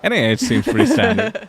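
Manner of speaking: amused. Real, not acted. Amused at spelling the slang.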